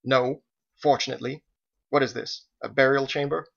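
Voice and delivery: mildly snappish retort; a touch of curt irritation; snapping. A narrator's reading. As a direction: snap the words at him. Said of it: "snapping her words at him".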